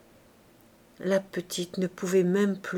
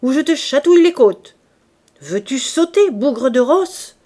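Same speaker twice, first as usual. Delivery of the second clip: loud, high threatening roar; angry bellow; 5 semitones higher, 10 dB louder than usual. A narrator's reading. Drunk father threatening his daughter with the whip, furious bellow. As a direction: yell it, dragging out the final insult. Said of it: "gueula-t-il plus fort".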